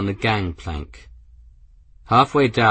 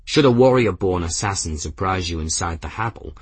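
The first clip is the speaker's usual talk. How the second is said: ordinary delivery, nothing marked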